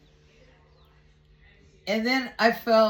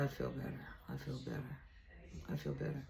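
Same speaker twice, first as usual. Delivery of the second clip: very soft, low murmur; tiny, low retold admission, pausing between repeats; hushed relief; 5 semitones lower, 16 dB quieter, much faster than usual. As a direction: repeat it almost under the breath. Retells relieved answer to Spirit.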